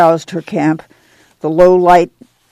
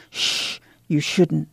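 hushing mother; 2 semitones lower, 10 dB quieter, slower than usual. A narrator's reading. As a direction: shush the child quietly. Ma hushes children at night.

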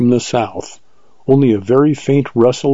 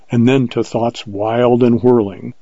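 ordinary delivery

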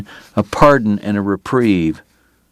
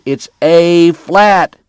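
high, loud and very slow, spelling it out; a drunk man correcting a pianist, drawn out; slow emphatic shout; 8 semitones higher, 4 dB louder, much slower than usual. Acted. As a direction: hammer home "flat" like a lesson. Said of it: "Doc shouted".

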